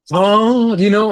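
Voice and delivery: startled by news that the players can't be paid; shocked oh. Retold or imitated. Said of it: "And I'm like".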